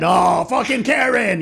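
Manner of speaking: high, fed-up outburst; mock exasperated shout. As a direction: yell it like an exasperated onlooker.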